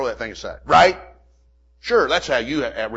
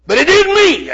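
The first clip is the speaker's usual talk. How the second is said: high emphatic; 10 dB louder than usual. Real, not acted. Admitting fear to congregation.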